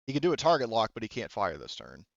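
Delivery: usual speaking voice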